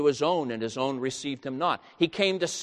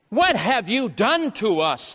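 higher and louder, bitter reproach; accusing cry; 7 semitones higher, 5 dB louder than usual. Acted. Israelites reproaching Moses, a loud bitter cry. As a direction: throw the blame at Moses.

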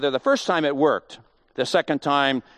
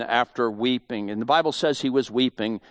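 ordinary delivery

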